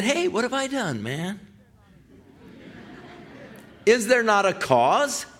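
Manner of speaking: high, youthful indignant protest; brash kid protesting. Retold or imitated. Young David protesting to brother.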